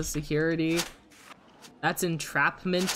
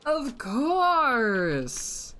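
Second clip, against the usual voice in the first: exasperated; 8 semitones higher than usual. Real, not acted. Opponent scores big combo.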